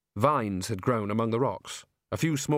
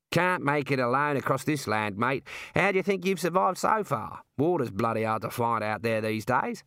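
pitched-up matey Aussie critter, inviting; matey talking kangaroo. Acted. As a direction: play a chummy Australian kangaroo. Figure of the kangaroo.

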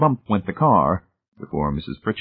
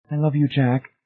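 quavering; 6 semitones higher than usual. A narrator's reading. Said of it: "Marcia quavered wretchedly".

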